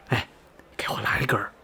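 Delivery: whispered, hushed request. Acted. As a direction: ask for it under your breath. Asks for a cigarette, hushed. (小声耳语)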